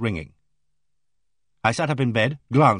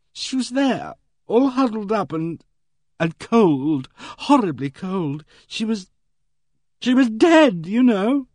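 high, halting, shaken voice; the girl tells of finding the dead maid, high and shaken; shocked and frightened; 10 semitones higher, 4 dB louder, slower than usual. Acted. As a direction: force the words out through the shock. Girl describing finding a corpse.

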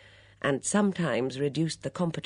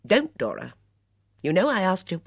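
louder, snapping at her friend; sharp rebuke; 5 semitones higher, 7 dB louder than usual. Acted. Miss Blacklock scolds muddled Dora, sharp and loud. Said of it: "She said sharply".

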